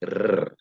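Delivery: one sustained trilled "Rrr"; rolled R. Shown as a demonstration. Actor explaining how to roll R's, trilled R. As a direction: trill the tongue on a long R. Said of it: "It's like you're making a".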